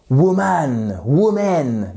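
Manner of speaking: "woman" in a French accent, full second vowel; French-accented "woman" with both syllables drawn out; French speakers' mistake. Shown as a demonstration. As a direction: imitate a French learner's heavy "woman", woo-MAN, woo-MEN. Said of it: "nous francophones, on va s'enferrer dans un".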